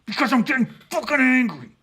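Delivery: high, loud, strained angry voice; acted anger. Shown as a demonstration. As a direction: push it out through compressed breath. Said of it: "shortness or compression of my breath".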